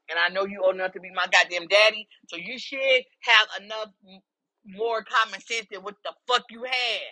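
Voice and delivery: normal talking voice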